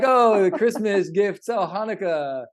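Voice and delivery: mock gushing. Parodying the American Christmas hype around Hanukkah, mock gushing. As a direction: ham up the holiday cheer.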